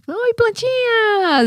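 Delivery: high, drawn-out cooing greeting; pet-talk voice. Retold or imitated. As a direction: switch to your talking-to-the-dog voice, very high and slow. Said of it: "E aí eu mudo a voz, do jeito que eu falo às vezes com um cachorro". (voz de falar com cachorro, bem aguda e esticada)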